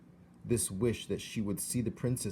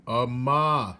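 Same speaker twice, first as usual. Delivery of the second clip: loud, raised shout; loud shout to be heard; 4 semitones higher, 10 dB louder than usual. A narrator's reading. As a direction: call it loud to a deaf girl. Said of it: "shouted the soldier".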